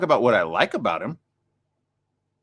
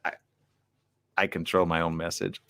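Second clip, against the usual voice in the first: ordinary talk, nothing marked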